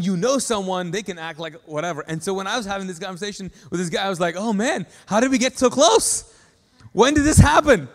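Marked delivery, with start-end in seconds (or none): 4.4-7.9 high, loud disbelief, rising as it goes; retold astonishment. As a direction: act out being floored, voice climbing. Retells stunned reaction to conversation.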